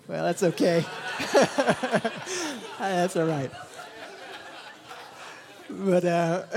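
laughing through the words. Real, not acted. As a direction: chuckle it off as you speak.

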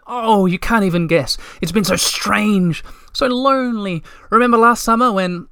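high, lightly voiced young troll, rushing out his loneliness; a lonely troll finally with a friend; eager Moomintroll. A narrator's reading. Moomintroll telling Little My how lonely he was, high and eager.

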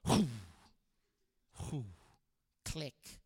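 dying whooshes ending in a tiny click; imitated door sounds. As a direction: puff out a cushioned door closing. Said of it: "it would just go".

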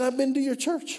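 high, eager doctor voice; lightly voiced doctor insisting; excited doctor. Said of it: "And he said".

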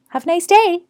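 high-pitched mock parting shot; storming-out sarcasm. Man storming out of the gym, sarcastic parting shot. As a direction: imitate someone flinging a goodbye while leaving. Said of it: "he stormed out and fucking slammed the door".

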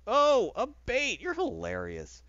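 slow, sarcastic put-down; mocking sarcasm. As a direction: feign amazement, then sink into slow deadpan. Acted mocking reply to Waller.

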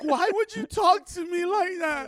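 mock teen wail, very high and drawn out; whining teenage girl. Daughter protesting to a grown man, mock whiny wail.

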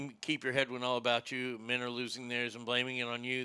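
poem recitation. Shown as a demonstration. Man showing the poem he learned in seventh grade, understated recitation.

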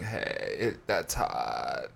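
low, slow, creaky vocal fry drawl; [name] vocal fry. Retold or imitated. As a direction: drop into a lazy creaky fry. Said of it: "That vocal fry thing".

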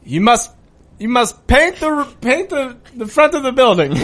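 put-on halting, hammy foreign-accent order; put-on European accent. Helper sentences the thief, put-on European accent.

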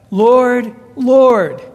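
loud pleading Lord, Lord; pleading cry. Pretend. Crowd on judgment day pleading loudly with Jesus. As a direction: call out to him, begging.

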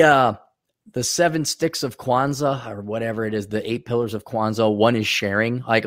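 ordinary talk

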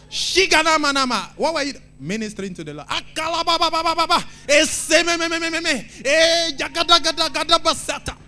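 high, loud frantic mock tongues; hammed-up noisy prayer. Shown as a demonstration. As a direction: parody a shouting prayer warrior. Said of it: "I did that to show this".

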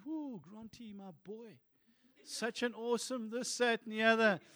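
put-on God speaking fondly to his boy; tender God voice.

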